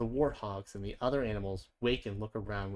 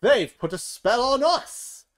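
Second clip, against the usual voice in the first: much higher, loud, slow outraged cry; angry outcry; 12 semitones higher, 11 dB louder, slower than usual. A narrator's reading. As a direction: shriek the blame. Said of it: "They stomp off angrily".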